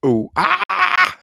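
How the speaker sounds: very high, drawn-out wrestler's cry; hammed-up wrestler impression; Monty Brown. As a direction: let out a high-pitched, stretched-out catchphrase yell. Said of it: "Monty Brown impression".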